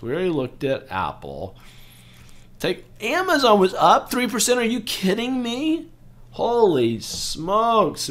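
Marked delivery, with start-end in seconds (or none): 2.6-5.9 much higher, loud disbelief; can't believe the number; surprised disbelief. Real, not acted. Vlogger shocked by Amazon's rise, high surprise. 6.3-8.1 higher, slow drawn-out holy smokes; amazed exclamation. Real, not acted. Vlogger reacting to Amazon's gain, slow amazement.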